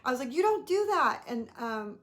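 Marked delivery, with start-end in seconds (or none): 0.3-1.2 loud, high sharp reprimand; sharp scolding. Retold or imitated. Mother scolding the boy, sharp loud rebuke. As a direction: snap it at the kid like a warning. Scolding a child who startled her.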